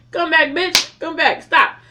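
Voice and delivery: loud, high hammed-up pleading; mock frantic yell. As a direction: shout someone back to consciousness, playfully overdone. Resumes mock revival after laughing.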